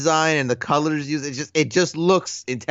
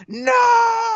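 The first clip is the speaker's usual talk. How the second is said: high, loud cry of denial; anguished scream; 5 dB louder than usual. Retold or imitated. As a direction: scream it as if your world just shattered. Imitating Luke's reaction to the reveal.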